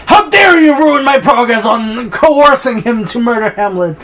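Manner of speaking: high, loud, drawn-out royal tirade; mock outraged king. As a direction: bellow in hammed-up indignation. Acts the angry king, exaggerated.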